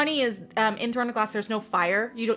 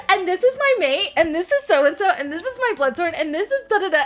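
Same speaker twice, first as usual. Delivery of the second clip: higher, laughing rattled-off introductions; breezy young queen; 9 semitones higher, 4 dB louder than usual. Retold or imitated. Teen queen casually introducing her fearsome court, laughing. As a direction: rattle off names like it's nothing. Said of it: "little nineteen-year-old queen who's like".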